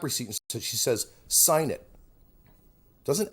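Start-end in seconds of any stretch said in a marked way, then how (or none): 1.3-1.9 higher, louder bossy demand; curt order. Retold or imitated. Fan ordering him to sign a Gap receipt, mock bossy command. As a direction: bark it like an order, no asking. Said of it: "just orders me".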